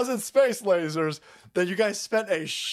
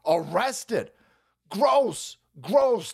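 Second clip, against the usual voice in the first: ordinary talk, nothing marked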